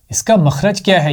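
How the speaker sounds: ordinary delivery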